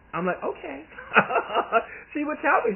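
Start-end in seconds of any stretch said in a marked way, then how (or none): 0.4-0.8 laughing okay. Retold or imitated. Reacting to aunt, laughing. 0.9-2.1 high laugh. Real, not acted. Laugh after retold exchange.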